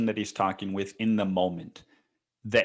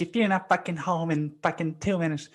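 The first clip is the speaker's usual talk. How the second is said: imitating angry wife; 9 semitones higher than usual. Wife threatening on phone.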